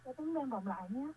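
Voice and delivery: faint echo fading away; distant radio feedback, fading out; last word echo. Real, not acted. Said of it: "cái tiếng vang nó làm cho Thảo My không nghe được".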